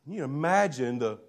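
ordinary delivery

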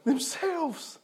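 soft answer. Real, not acted. Rhetorical answer, dropped soft.

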